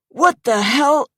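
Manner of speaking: high, loud startled cry; jolted out of it, shouting. Acted. Woken man shouts at intruders, startled and loud.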